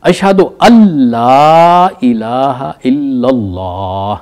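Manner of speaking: slow recitation, "ان لا" merged into "اَلّا" with a doubled l; recited with silent noon. Shown as a demonstration. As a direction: chant it slowly, the noon swallowed into the l. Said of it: "silent کر دیتا ہے".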